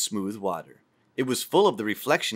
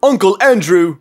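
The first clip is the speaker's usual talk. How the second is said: louder sudden shout of recognition; shouted name; 9 semitones higher, 13 dB louder than usual. Acted. Boy remembers his uncle and shouts out. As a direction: call it out the instant it comes back. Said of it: "he shouted out".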